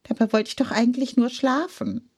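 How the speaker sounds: whiny hen. A narrator's reading. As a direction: whine that you only wanted to sleep. Hen whining, wanted sleep. (quengelig und schnell)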